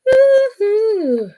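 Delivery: long, high whoop falling at the end; drawn-out playful cheer; high woo-hoo. Real, not acted. Crafter cheering at her shaking, high whoop.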